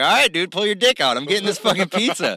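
retold challenge, pushed up; defiant dare. Retold himself accepting the dare.

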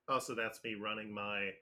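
normal talking voice

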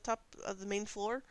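ordinary talk, nothing marked

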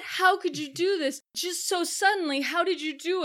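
high, indignant disbelief; hammed-up shock; mock outrage. The tenants protesting the eviction, high and indignant. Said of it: "Everyone's like".

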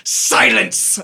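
sharp hissed command. Acted. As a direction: cut him off with a hiss. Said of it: "the throne figure interrupts".

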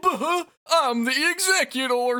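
high, loud goofy cartoon voice; dopey chuckle into a big mock announcement. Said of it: "Goofy, just said it".

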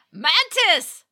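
loud, high alarmed scream; sailor screaming. A narrator's reading. Sailor screaming an alarm at the pirates. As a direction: scream the warning. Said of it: "screamed one of the sailors".

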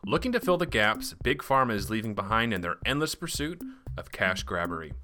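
upbeat, bright-toned ad read; chipper 1950s ad. Acted. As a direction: sell it like an old radio ad. Sponsor ad read.

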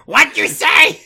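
badly imitated hype-rapper shout. Co-host interrupting the reading, mock rapper holler. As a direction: yell it out like a party rapper. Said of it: "that is a terrible [name] voice".

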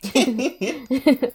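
high loud laugh; high burst of laughter. Real, not acted. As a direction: crack up laughing. Laugh at monster imitation.

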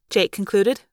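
usual speaking voice

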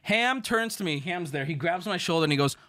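ordinary talk

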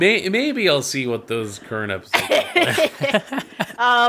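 laughing through the words; giggling while talking. Real, not acted. Laughing over a joke.

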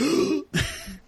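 high mock gasp; imitated gasp. Lorelai opens the measurements, acted startled gasp. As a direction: draw a sharp, high-pitched breath in mock shock. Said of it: "she's like".

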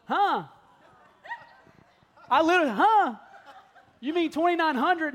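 loud, high "Huh?" of retold shock; shocked disbelief. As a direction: blurt it out, stunned by the price. Shocked at repair price.

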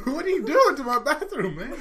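high, loud comic protest; mock outrage. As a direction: cry out in hammed-up alarm. Acting alarmed host of clogged bathroom.

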